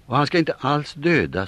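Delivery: mycket högre, ivrig bön; be för honom ivrigt; ivrig kvinnoröst. Acted. Kvinnorna ber för prästen. (högre, ivrig kvinnoröst)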